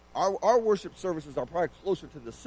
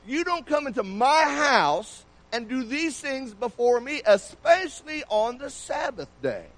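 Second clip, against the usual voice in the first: higher, slow, a touch of offended official; mild indignation; 6 semitones higher, 4 dB louder, slower than usual. Acted. Synagogue official scolding Jesus over the Sabbath, mildly indignant. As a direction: lay down the rule, slow and put out.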